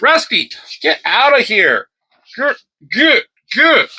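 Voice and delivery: shooing the cat. Real, not acted. Scolding the cat off camera.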